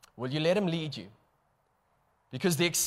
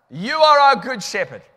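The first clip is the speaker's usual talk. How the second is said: loud prayer; 6 semitones higher, 9 dB louder than usual. Real, not acted. Praying aloud with full force.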